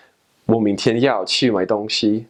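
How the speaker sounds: 我明天要去买东西 said in native Mandarin tones; Mandarin sentence. Shown as a demonstration. Language learner showing how a Chinese speaker says it, in Mandarin. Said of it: "You'd say, like".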